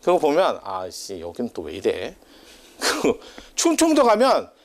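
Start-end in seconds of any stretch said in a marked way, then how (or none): 0.6-2.2 soft, half-swallowed mock grumble to himself; a quiet retold curse of disbelief; muttered grumble. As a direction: grumble it under your breath like a private thought. Retold inner grumble at bathhouse.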